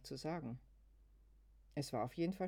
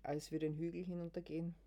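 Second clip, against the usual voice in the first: ordinary delivery, nothing marked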